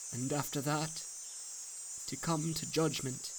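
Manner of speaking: a soft, hushed man in anguish speaks to his family; burdened husband pleading softly; grieving father. Acted.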